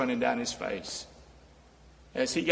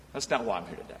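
dropped low and soft, almost to himself; quiet repeat; 7 semitones lower, 8 dB quieter, much faster than usual. Acted. Preacher repeating to the congregation, low and soft. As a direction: let the repeat fall much lower and softer.

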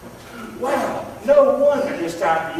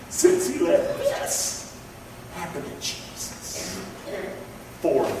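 hushed; 2 semitones lower, 6 dB quieter, slower than usual. Real, not acted. Hushed point about Jesus.

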